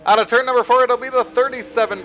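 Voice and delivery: loud, high finish call; motorsport commentator. Real, not acted. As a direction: belt out the winner at the line. Calling the finish.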